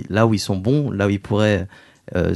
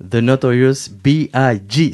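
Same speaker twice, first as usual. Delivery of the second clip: slow, drawn-out hip-hop announcer English accent; English accent attempt; 3 semitones higher, 6 dB louder, slower than usual. Shown as a demonstration. Interviewer announcing the rapper in a slow put-on English accent. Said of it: "C'était bon, là, l'accent, non?".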